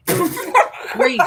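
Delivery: laughing through the words, high and loud. Real, not acted. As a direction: break into a laugh mid-line. Laughs mid-sentence.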